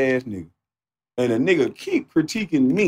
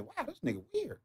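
higher and much softer, almost breathed; high soft aside; 13 semitones higher, 17 dB quieter than usual. Retold or imitated. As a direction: breathe it out in mock disbelief. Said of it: "That's all I can say is".

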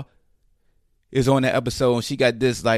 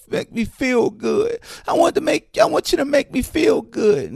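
mock needy voice; 11 semitones higher than usual. Mimicking needy attention-seeker.

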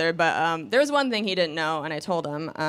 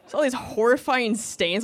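ordinary talk

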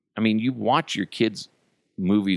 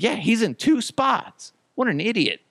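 imitating son; 14 semitones higher than usual. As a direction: play the little boy pointing out the obvious. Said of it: "He's like".

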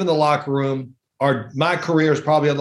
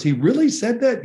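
mock disbelief; 7 semitones higher than usual. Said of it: "he goes".

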